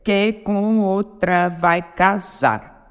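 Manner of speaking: very slow, syllable by syllable; contando sílabas. Shown as a demonstration. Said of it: "conte comigo". (escandindo devagar, sílaba por sílaba)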